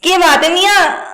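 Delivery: high, playful Bhopali lilt; Bhopali accent. Shown as a demonstration. Guest turns the host's sentence into Bhopali accent. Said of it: "इसको क्या बोलेंगे भोपाली में".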